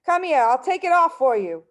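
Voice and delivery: wife's voice. A narrator's reading. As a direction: offer to yank it off, briskly.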